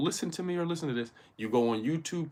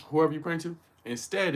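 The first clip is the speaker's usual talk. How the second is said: ordinary talk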